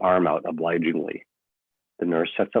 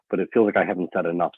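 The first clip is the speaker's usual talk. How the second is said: usual speaking voice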